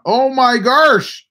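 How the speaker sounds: high, loud, slow drawn-out exclamation; mock dismay. Real, not acted. Streamer reacting to chat's Santa panic, hammed-up dismay. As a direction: throw up your hands, playfully.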